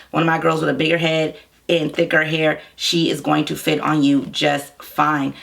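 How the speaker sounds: ordinary delivery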